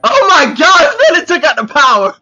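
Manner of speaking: loud, laughing oh my God; laughing shout. Real, not acted. Vlogger laughing loudly when the power cuts out. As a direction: laugh it out in disbelief.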